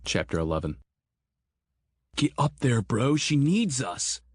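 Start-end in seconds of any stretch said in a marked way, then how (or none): 2.1-4.2 yelling. Acted. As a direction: shout it over the engine, urgent. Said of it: "Grant yelled".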